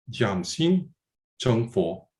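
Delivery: "Jiangxin Zhengfo" said as Mandarin words, not anglicized; Chinese pronunciation. Shown as a demonstration. Lecturer quoting the Chan adage to students, saying "Jiangxin Zhengfo" in Mandarin. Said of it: "please forgive my accent and my, my pronunciation".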